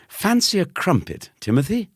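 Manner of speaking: very high, posh-sounding offer; a hint of a snooty schoolboy; snooty cousin. A narrator's reading. Cousin snootily offering the boy a crumpet.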